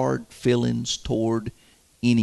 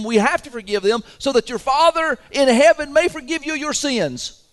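fired-up preaching; 8 semitones higher than usual. Real, not acted. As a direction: drive it home to the church. Preaching at full force.

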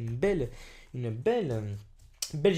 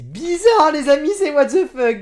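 louder, higher exclaim; loud disbelief; 9 semitones higher, 11 dB louder than usual. Real, not acted. Youtuber telling friends the sticker is crazy, loud disbelief. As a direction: call it out to everyone.

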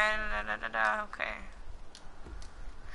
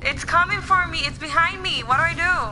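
being chased; 8 semitones higher, 4 dB louder than usual. Real, not acted. Chased by a mob in game.

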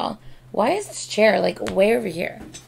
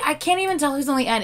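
ordinary talk

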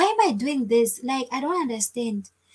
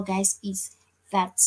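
ordinary delivery

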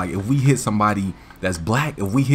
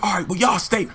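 stern man voice; 8 semitones higher than usual. Retold or imitated. Driver voiced.

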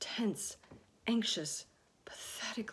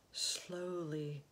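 ordinary delivery, nothing marked

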